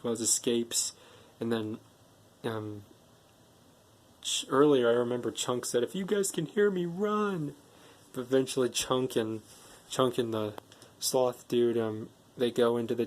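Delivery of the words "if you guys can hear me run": high-pitched mock call to friends; imitated warning call. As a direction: call out high without real volume. Chunk calling a warning to friends.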